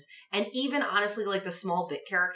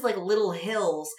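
ordinary delivery

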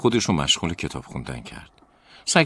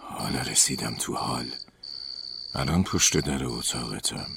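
whispering ghost; 3 semitones lower, 6 dB quieter than usual. A narrator's reading. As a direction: creep closer in a hushed ghostly voice. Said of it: "همون صدای پچ پچ وار گفت".

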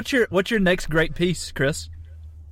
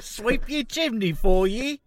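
mock Cockney chimney sweep; 4 semitones higher, 3 dB quieter than usual.

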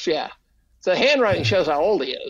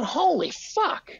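awed disbelief; 9 semitones higher, 3 dB quieter, slower than usual. Retold or imitated. Amazed by child's drawing.

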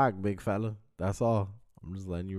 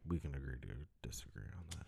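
whispering; 7 semitones lower, 18 dB quieter, much faster than usual. Real, not acted. Backing off a heated argument.

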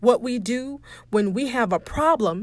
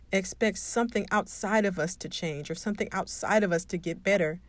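ordinary delivery

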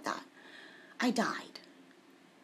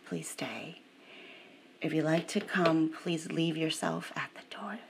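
low, hushed invitation into prayer; guided meditation; 4 semitones lower than usual. Real, not acted. Inviting listeners to come into the Father's house, hushed meditation. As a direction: lower and soften gradually to a near whisper.

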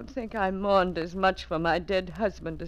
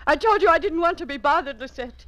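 high, loud, fast snap; irritated snap; 9 semitones higher, 6 dB louder, faster than usual. Acted. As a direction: cut her off, you're not to be bothered. Snapping at interrupting maid.

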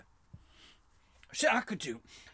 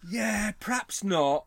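slow, higher, loud let-down; mock doubtful reply; 7 semitones higher, 6 dB louder, much slower than usual. Host voicing the unimpressed girls, slow mock doubt. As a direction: drag it out as a comic put-down. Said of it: "And they go".

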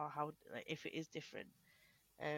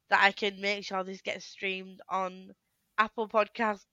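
normal talking voice

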